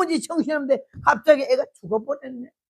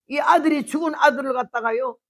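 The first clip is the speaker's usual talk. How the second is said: usual speaking voice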